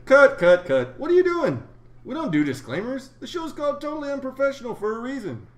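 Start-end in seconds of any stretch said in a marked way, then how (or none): 0.0-1.6 higher, louder call to cut; interrupting call. Real, not acted. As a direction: stop him mid-sentence. Cutting off the disclaimer.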